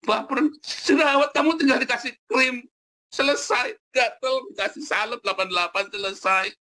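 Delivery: high-pitched, exaggerated mock whine; high comic voice, stretched words; mock fussing. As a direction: make a silly fuss over tiny complaints. Mock fuss over pimple and itch.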